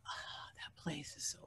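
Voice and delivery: hushed, breathless whisper about the place; eerie whisper. Real, not acted. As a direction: whisper it like the walls can hear. Describing the haunted hotel.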